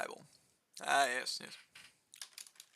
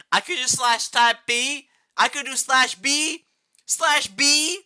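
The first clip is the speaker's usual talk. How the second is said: high, loud, slow disbelieving rant; high-pitched squawk of outrage; gamer rage; 11 semitones higher, 8 dB louder, slower than usual. Real, not acted. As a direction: rant it like you can't believe the game. Raging at the command.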